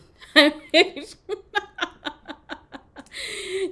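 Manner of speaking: high, loud laughing fit; long hearty laughter. Real, not acted. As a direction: laugh helplessly at your own joke. Cracks up at own accent.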